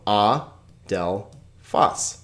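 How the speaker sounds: a, del, phos, each set apart; syllable by syllable. Shown as a demonstration. Teacher showing why they're divided, a, del, phos spaced. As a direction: pause between a, del and phos. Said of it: "that's why they're divided".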